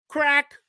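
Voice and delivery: very high, sharp crack noise; neck crack. Retold or imitated. Imitating the killer breaking the boyfriend's neck, high sharp crack. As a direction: snap out the sound of bones.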